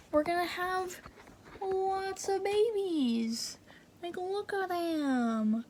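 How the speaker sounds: high, soft, drawn-out cooing. Real, not acted. Vlogger showing viewers the tadpole babies, cooing. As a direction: coo over tiny babies, stretching the words slowly.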